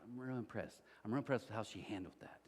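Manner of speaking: wife quietly tells husband she's impressed, hushed and warm; quiet admiration. Retold or imitated. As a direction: murmur it like a proud mom in the bleachers. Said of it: "my wife would be like".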